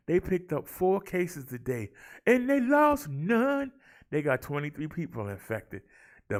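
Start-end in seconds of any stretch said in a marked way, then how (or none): 2.3-3.7 "lost" pitched way up and drawn out slowly; slow, high sing-song on "lost none"; punching lost. Acted. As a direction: hit "lost" high and hold it. Stat called out with emphasis.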